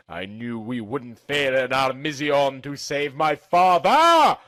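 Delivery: plain reading voice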